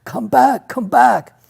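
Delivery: high, loud retold scream; frantic screaming. Guide calls the kayaker back from danger, screaming. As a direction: imitate someone screaming a frantic warning. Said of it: "jumping up and down, screaming".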